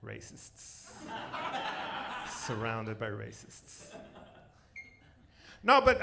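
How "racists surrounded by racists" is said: whispered aside, barely voiced; mock-sulky whisper to the crowd; muttered under breath. Real, not acted. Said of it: "mock offended at audience".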